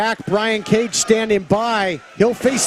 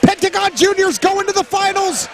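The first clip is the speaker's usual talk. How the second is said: excited play-by-play; 9 semitones higher than usual. Real, not acted. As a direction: build to the big call. Finisher, commentator building.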